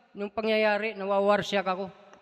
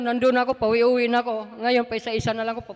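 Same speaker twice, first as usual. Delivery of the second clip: ordinary delivery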